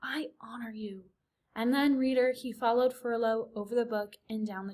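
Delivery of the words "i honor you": hushed falling whisper. A narrator's reading. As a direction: whisper it again, fading. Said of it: "whispered Despereaux".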